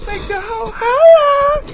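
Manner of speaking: drawn-out high holler to hype the crowd; hyped sign-on holler. Pretend. Host hyping listeners with his rhyming intro, high holler. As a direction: stretch it into a soaring, loud crow.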